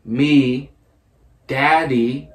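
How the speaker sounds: each word stretched and separated; word by word, with long gaps. Real, not acted. Player repeats name for puzzled friend, slow and separated. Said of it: "Say it again, slower".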